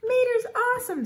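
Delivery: very high, loud squeal of admiration; adoring fan cheer. A narrator's reading. Second fan cheers the rescuer, squealing high and loud. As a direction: gush like a thrilled little fan.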